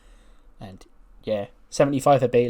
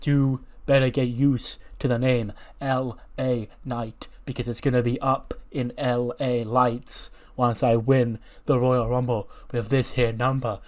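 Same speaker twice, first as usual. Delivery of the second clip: L A Knight promo. Retold or imitated. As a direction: taunt the room like a megastar. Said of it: "He goes".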